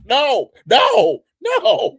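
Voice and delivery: high, loud mock refusal; mock protest. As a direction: shriek the refusal, half laughing. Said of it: "She's like, like".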